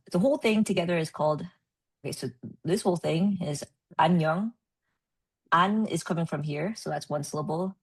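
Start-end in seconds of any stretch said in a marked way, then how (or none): 4.0-4.5 안녕 said as ahn-nyung; Korean pronunciation. Shown as a demonstration. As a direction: demonstrate 안녕 as two joined Korean syllables. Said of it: "what would it sound like".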